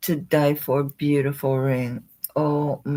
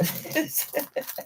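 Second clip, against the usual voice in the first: breathy laugh; 12 semitones higher, 8 dB quieter than usual. Real, not acted. Laughing at her stash.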